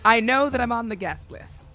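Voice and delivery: loud, high, self-important insistence; hammed-up guest, projecting loudly; Spiro Curry. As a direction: insist grandly at the door. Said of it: "as Spiro Curry".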